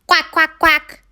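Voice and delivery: high, short quacks; calling ducks. Acted. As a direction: call out like huddled ducks. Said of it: "they called".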